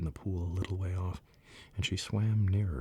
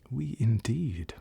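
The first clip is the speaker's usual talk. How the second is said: trembling indignation; 5 semitones higher, 4 dB louder, much slower than usual. Acted. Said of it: "trembling down to the end of its tail".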